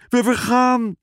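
frightened sailor shrieking over the wind; panicked shout. A narrator's reading. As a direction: yell it as the storm breaks. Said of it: "schreeuwde Piet".

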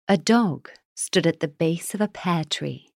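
ordinary delivery

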